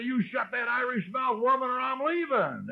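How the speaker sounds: retold angry threat. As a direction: threaten to walk out, high-pitched and hammed-up. Acted quarrel with his wife.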